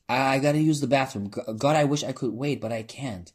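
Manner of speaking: stammering, rushed and pressed; urgent panic. Acted. Narrator panicking to the drag queens about the bathroom, urgent. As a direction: blurt it out desperate to get away.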